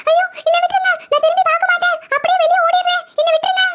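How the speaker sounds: high-pitched panicky begging; acted fright, high voice; terrified pleading. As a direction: plead to be let go, scared. Frightened woman pleading with ghost.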